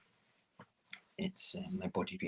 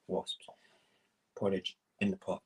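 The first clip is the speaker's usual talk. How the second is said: ordinary talk, nothing marked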